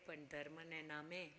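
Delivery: ordinary delivery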